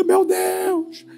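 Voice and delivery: high, panicky woman's voice; Cremilda's cry. Acted. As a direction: squeal it in mock alarm. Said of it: "E Cremilda grita".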